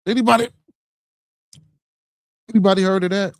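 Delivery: loud, high call to the chat. Real, not acted. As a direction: throw the question out to everyone. Calling out to the chat.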